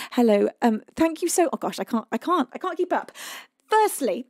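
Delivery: ordinary delivery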